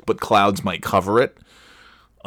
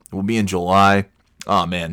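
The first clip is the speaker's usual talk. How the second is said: ordinary delivery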